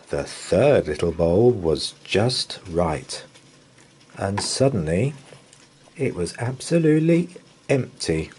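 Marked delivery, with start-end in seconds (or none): none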